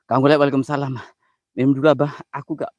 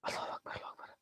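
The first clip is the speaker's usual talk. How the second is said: whispered prayer; 20 dB quieter, faster than usual. Real, not acted. Whispered takbir.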